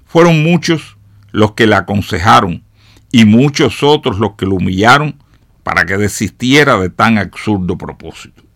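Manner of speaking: usual speaking voice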